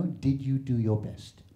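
soothing European parent; gentle parent. Retold or imitated. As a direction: ask it kindly and softly. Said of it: "Only one question they wanna know".

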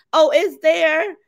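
ordinary delivery

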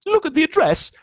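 very high, loud anxious voice; Aunt Petunia quivering. A narrator's reading. Aunt showing husband the address, high quivering voice. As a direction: quiver anxiously without any accent. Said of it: "was saying in a quivering voice".

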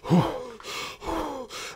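heavy puffed breaths, acted; laboured breathing. Shown as a demonstration. Tripoding patient shown to students, laboured breathing. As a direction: puff hard like a struggling patient. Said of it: "somebody sitting in the chair, tripoding".

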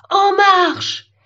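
loud, high battle command; forceful high call to march; rallying shout. Acted. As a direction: rouse the troops to march. Tree rallies others to march.

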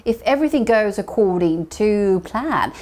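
drawn-out teaching read; slow model sentence; slow clear model. Shown as a demonstration. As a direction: spell it out slowly for learners. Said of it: "어떻게요?".

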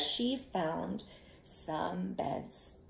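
ordinary delivery

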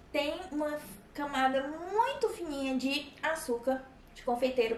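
chewing while talking; talking between bites; mouth full. Real, not acted. Seen 2: Holds pastry and chews with closed mouth throughout; bites at 6.5-7.1.

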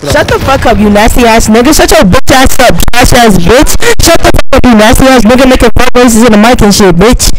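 loud angry trash talk; yelling. Real, not acted. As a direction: yell it at them.

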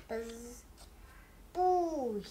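ordinary delivery, nothing marked

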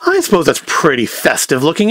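ordinary talk

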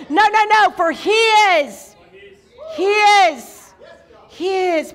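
high, loud, rhythmic declamation; full-force preaching. Real, not acted. Preacher declares God's plan for those who love him, forceful preaching. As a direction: punch each phrase, stretching key words.

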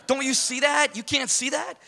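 high, loud pleading insistence; insistent shout. Retold or imitated. He insists to his buddy in a high, loud shout. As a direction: make him see it. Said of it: "I'm like".

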